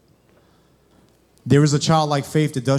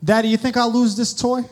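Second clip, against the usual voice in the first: put-on higher little boy voice asking Daddy; childlike question, pitched up; Aiden; 6 semitones higher than usual. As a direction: imitate a child checking with his father. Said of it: "he takes the toy to me and he goes".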